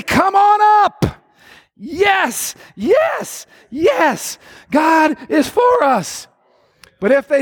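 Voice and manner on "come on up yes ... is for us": excited yes. Acted. Preacher acts the hoped sign.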